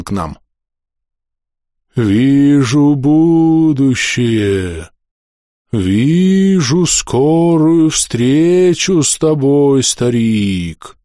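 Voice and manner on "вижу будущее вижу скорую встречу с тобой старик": slow, rustling ghostly prophecy; prophetic trance voice. Acted. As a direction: drag each word out like a ghost foretelling doom. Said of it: "едва слышно прошуршало привидение".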